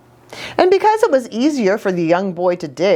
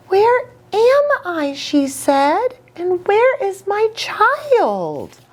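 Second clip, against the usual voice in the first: lightly voiced mother panda, a touch higher and anxious; a hint of a frightened mother animal asking for her child; worried mother panda; 11 semitones higher, slower than usual. Injured panda wakes in storm.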